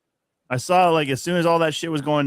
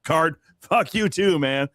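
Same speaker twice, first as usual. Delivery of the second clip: slow, high mock ref retort; mock referee; 5 semitones higher, slower than usual. Podcaster voicing a nineties referee, slow and high. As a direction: drawl it like a fed-up ref. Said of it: "all of a sudden, like".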